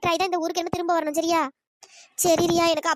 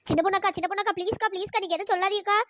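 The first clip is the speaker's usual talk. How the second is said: ordinary delivery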